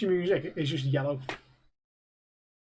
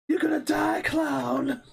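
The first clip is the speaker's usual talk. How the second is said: mock movie threat; 8 semitones higher, slower than usual.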